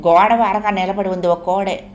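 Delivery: ordinary delivery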